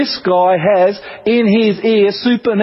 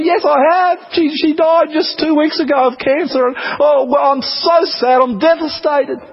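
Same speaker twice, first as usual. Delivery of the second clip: imitating a tearful woman; 6 semitones higher than usual. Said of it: "And she'll start to cry".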